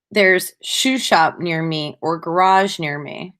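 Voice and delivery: usual speaking voice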